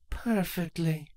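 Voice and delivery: soft, slow, smug; smug soft reply. Acted. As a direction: say it softly, savoring.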